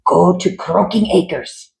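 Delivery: slow, lightly voiced mad doctor orders; commanding doctor. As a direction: give the order like a mild mad scientist. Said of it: "he instructed the Mungers".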